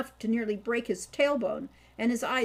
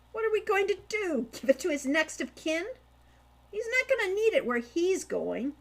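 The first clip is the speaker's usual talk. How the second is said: high, louder mocking retort; scoffing; 9 semitones higher, 4 dB louder than usual. Hawk scoffing at his brother's scruples, mocking retort.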